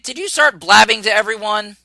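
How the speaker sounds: normal talking voice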